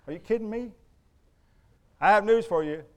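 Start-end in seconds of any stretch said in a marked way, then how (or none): none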